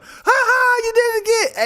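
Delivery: high, loud, squealing hype-man voice; cheering land voice. Acted. The land cheering a lucky thrower, high and loud. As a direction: shriek it like a crowd egging you on.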